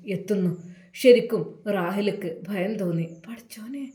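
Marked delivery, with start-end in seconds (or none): 3.3-3.9 hissed whisper through teeth. Acted. Rahila calling her son in the lockup, whispered through teeth. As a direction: whisper it, teeth clenched in anger. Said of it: "പല്ല് നിരിച്ചുകൊണ്ട് അതാ റാഹില".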